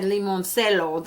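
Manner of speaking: "limoncello" in Spanish, lee-mon-SEH-yo or SELL-oh; Spanish pronunciation. Shown as a demonstration. Vlogger showing her own Spanish way, "limoncello" with Spanish vowels. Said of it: "pronounce this in Spanish".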